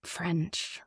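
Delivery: soft, unvoiced whisper; hushed one-word reply. Real, not acted. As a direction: whisper the answer like a confession. Savouring the favourite accent answer.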